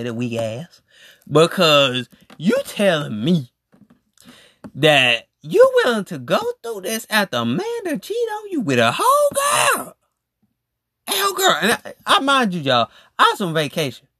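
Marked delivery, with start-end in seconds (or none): 4.7-11.6 outraged shout. Real, not acted. Host ranting at the cheated girlfriend, in a high outraged shout.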